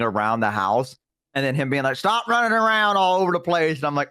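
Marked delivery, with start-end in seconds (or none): 1.9-3.8 raised-pitch mock scolding; retold scolding. Papa to a kid running round the house, mock scolding. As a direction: call out a mild telling-off.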